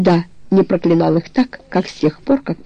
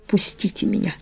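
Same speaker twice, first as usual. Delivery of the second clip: breathless, much softer near-whisper; gasping; 9 dB quieter than usual. A narrator's reading. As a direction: force it out with hardly any breath. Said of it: "выговорил он, задыхаясь". (задыхаясь, почти шёпотом)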